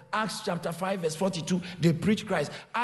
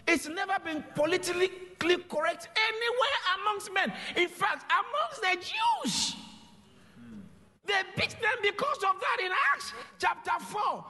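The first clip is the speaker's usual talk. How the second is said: preaching at full force; 10 semitones higher than usual. Acted.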